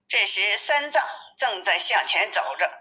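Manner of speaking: usual speaking voice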